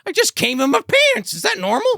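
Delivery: very high, excited put-on voice; mock giddy confession. Psychopath confessing after the kill, giddy mock excitement. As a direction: blurt it in a silly high pitch.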